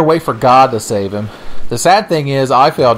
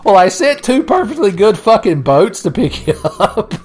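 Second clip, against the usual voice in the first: laughing, high exasperated God voice; God losing patience, laughing; 11 semitones higher than usual. Retold or imitated. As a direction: play God fed up and cracking up. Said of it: "God's like".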